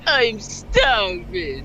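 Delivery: slow, drawn-out whine; whingy starving. Acted. Playing the whingy reader in the coffin, slow whine. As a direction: moan it like a helpless weakling. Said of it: "such a whingy little bitch".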